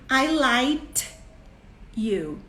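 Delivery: "liked" said as "light", ending in a t; child's mispronunciation. Retold or imitated. Child telling mom affection, "liked" mistakenly said as "light". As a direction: put a t in place of the k in "liked". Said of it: "Ele queria falar like".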